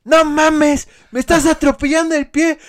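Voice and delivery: frantic high shout of pain; panicked yell. Retold or imitated. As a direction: yell in a panic, foot crushed. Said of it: "el pinche motociclista así ... bien apurado".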